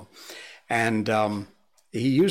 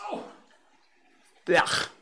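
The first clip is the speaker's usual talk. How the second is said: normal talking voice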